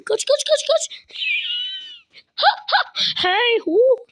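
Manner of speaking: breathless high urging; frantic high whisper-yell. Real, not acted. Urging villagers to flee, high breathless yell. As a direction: hiss run run run.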